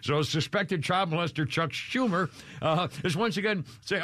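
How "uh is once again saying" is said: ordinary talk, nothing marked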